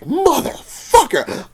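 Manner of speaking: high, drawn-out cursing; retold anger. Storyteller cursing after customer walked away, mad and drawn out. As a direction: stretch the curse out in exasperation. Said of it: "I was so mad".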